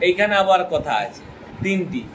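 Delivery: normal talking voice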